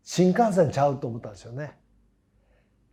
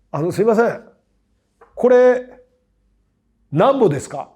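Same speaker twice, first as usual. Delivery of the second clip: higher, louder attempt at standard Japanese; forced standard Japanese; 8 semitones higher, 9 dB louder than usual. Young Osakan asking a price in a Tokyo shop, forced standard Japanese. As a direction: put on careful Tokyo speech, Osaka word slipping in. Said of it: "無理して使おうとしてて".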